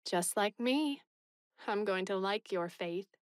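decidedly. A narrator's reading. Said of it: "said Anne decidedly".

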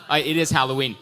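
ordinary talk

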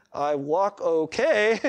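slow, drawn-out baffled reply; puzzled outsider. Acted. Outsider answering odd church jargon, puzzled and slow. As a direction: answer haltingly, stretching the words. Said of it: "They're like".

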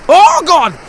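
loud, high-pitched cry; startled gamer outburst; alarmed shout. Real, not acted. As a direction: cry out in sudden panic. Near goal against him.

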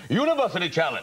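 high, ringing quiz-show announcer; huge-guy announcer. Acted. Comic recalling that quiz voice, huge-guy announcer. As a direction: boom out the show title grandly. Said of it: "all imagine it's some huge guy".

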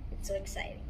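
whispered, hushed excitement; soft whispered thrill; whispered aside. Real, not acted. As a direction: breathe it out like a secret. Reading a kids' book, mock excitement.

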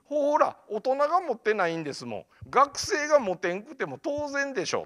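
high-pitched, emphatic told-you-so; triumphant retort. Retold or imitated. Student shuts down adults asking about his dream, triumphant retort. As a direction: throw it back at the adults.

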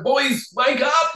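high, slow singsong call through laughter; laughing wake-up call. Retold or imitated. Waking Finn and Mitch, high, slow laughing call. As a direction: coax the boys awake, giggling. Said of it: "I was like".

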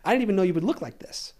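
high-pitched, emphatic retold reassurance; animated reassurance. Retelling director's animated reassurance.

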